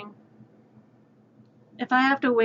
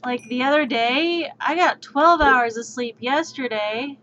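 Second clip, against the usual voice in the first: usual speaking voice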